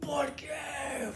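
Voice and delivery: high, shouted Spanish cry of despair; ¿por qué? cried out high; mock anguished cry. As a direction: wail it like a betrayed diner. Mock anguish after tasting ketchup wings.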